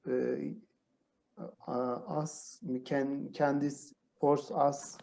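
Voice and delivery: ordinary talk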